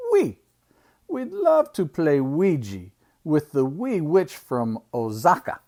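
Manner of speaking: put-on lightly French-accented leopard. Uncle voicing a storybook leopard, light French accent. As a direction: play it with a hint of French accent. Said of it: "The French leopard says".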